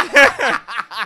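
high, loud burst of laughter. Real, not acted. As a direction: crack up loudly. Laughing at the claim.

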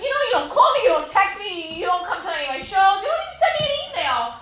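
high, loud, speeding rant; mock-furious laying into him; ranting complaint. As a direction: let it all out at him. Laid into him.

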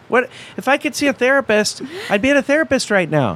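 high-pitched, incredulous letter writer; indignant mock outburst, pitched high; exasperated. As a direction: protest in mock exasperation, voice jumping up.

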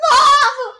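high wailing cry of Mom, loud; sobbing shout of Mom. Retold or imitated. Imitating Victoria, sobbing Mom to her mother. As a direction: wail for mom down the phone. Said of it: "calls her mom and goes".

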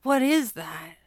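understated, let-down question; quiet disappointment. A narrator's reading. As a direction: ask in dismay, pitch a little up. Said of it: "quiet and full of disappointment".

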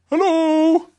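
bouncy odd creature voice; Tigger voice. A narrator's reading. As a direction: strange animal at the door.

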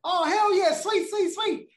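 high, loud, squealing delight; girlfriend's excited cheer. Retold or imitated. Girlfriend cheers about his TV news, high and thrilled. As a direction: cheer like she just heard great news.

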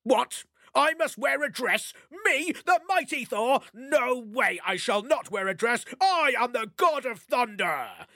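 hammed-up offended god; indignant Thor. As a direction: refuse the dress in mock outrage. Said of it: "growling sulk".

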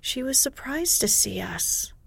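usual speaking voice

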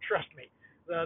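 ordinary talk

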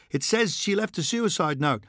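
lightly voiced reporter prodding Thorne; pushy reporter. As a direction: needle him with the news. Reporter firing questions.

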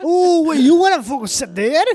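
very high, loud mock Scottish; hammed-up Scottish yelp; insane Scottish accent. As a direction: shout it in overdone Scottish. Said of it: "Imagine ordering bagels in Scotland".

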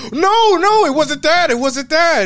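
high, loud panicked "no, no"; flustered denial. Retold or imitated. Guy flustered denying racism.

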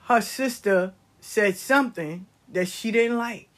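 usual speaking voice

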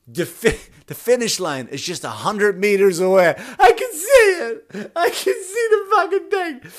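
high, loud hammed-up blubbering; mock crying app voice. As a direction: blubber the app's words through fake tears. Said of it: "And she was like".

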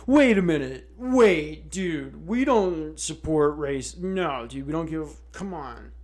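mock corporate backpedal; mock NFL voice. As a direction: play the league pleading, half laughing. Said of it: "the N F L is like".

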